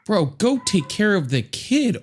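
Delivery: ordinary delivery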